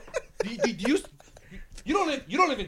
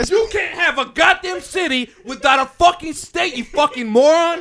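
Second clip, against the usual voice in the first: slow, loud, incredulous shouting; heated comeback; 4 semitones higher, 7 dB louder, slower than usual. Real, not acted. Friend arguing back about city and state, heated shout. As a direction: shout it down word by word.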